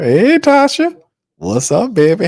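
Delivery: high, bright call to a friend arriving; calling out hello to a viewer who joined; excited greeting. Real, not acted. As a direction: greet her like she just walked in.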